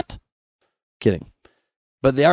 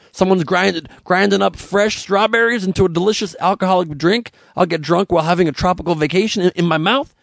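usual speaking voice

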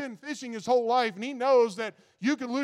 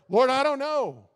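loud, higher cry out to the Lord; pleading prayer; 5 semitones higher, 8 dB louder than usual. Pretend. Rider crying out to the Lord for help, loud and pleading. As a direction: plead out loud, half-acted.

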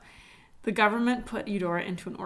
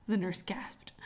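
ordinary delivery

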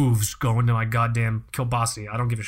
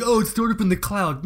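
mocking quote; 6 semitones higher than usual. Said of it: "It's like".

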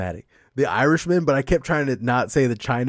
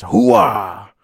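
louder, barked hoo-ah in a put-on Pacino voice; Al Pacino yell; 4 semitones lower, 8 dB louder than usual. Friend mocks Pacino's trademark catchphrase, loud bark. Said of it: "he's just yelling".

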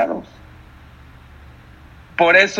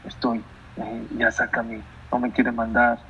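hidden plea; 4 semitones lower, 7 dB quieter than usual. Retold or imitated. Son secretly begs his father, soft mild pleading. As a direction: lower and softer, a lightly voiced pleading son. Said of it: "entre líneas le ponía".

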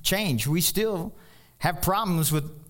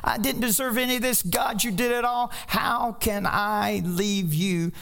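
tearful thanks; 9 semitones higher than usual. Retold or imitated. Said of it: "Tears started streaming down his eyes".

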